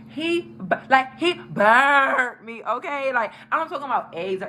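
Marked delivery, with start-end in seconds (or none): 0.1-2.6 slow dramatic emphasis. Real, not acted.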